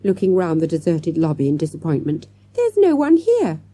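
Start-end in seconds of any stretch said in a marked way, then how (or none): 2.5-3.6 put-on high-pitched child's complaint; disappointed child. A narrator's reading. Child finding the deserted lobby empty, disappointed. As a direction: voice a let-down youngster, pitched high.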